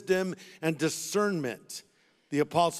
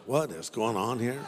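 puzzled thought; 7 semitones lower, 4 dB quieter than usual. Retold or imitated. Preacher wonders about stall stranger, lightly puzzled inner voice. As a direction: lower retold inner voice. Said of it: "I'm thinking".

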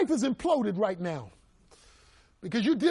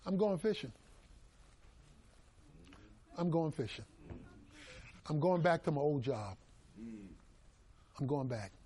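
quiet defeated Peter; 6 semitones lower, 10 dB quieter than usual. Acted. Peter voiced giving up, quiet.

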